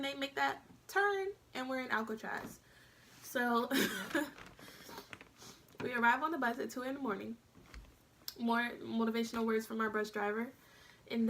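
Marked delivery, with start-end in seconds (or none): 3.7-5.5 laugh. Real, not acted. Laughs at her own story.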